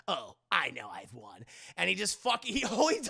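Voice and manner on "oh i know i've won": low, softer gloat after a chuckle; chuckle, then drop low and smug; smug villain. Retold or imitated.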